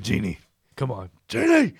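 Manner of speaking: lightly voiced Wolverine coaxing. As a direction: coax her like Wolverine. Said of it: "He was more like".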